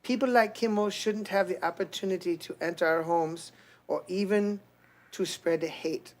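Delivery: put-on soft Slavic-accented First Lady reading; quiet put-on accent of a First Lady; Melania accent. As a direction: keep up the soft Eastern European accent. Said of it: "That's close, that's close".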